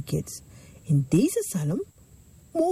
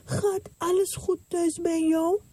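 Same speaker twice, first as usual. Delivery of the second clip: servant asking; 8 semitones higher than usual. Acted. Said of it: "Knecht vroeg aan de vrouw".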